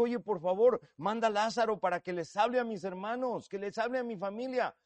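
a touch of a worried rich man begging; anxious rich man. Acted. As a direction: plead on behalf of his brothers. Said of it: "Él dijo".

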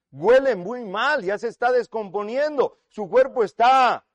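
loud, high, drawn-out objection; emphatic protest. Acted. Repeats acted protest with force.

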